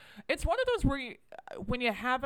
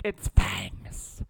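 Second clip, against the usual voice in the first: bitten werewolf in a low, whispery voice; Christopher Walken; 12 semitones lower, 6 dB louder than usual. Acted. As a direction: whisper it low and louder, as a bad celebrity impression. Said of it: "Christopher Walken when he was, after being bitten by a werewolf".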